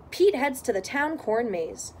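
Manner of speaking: plain reading voice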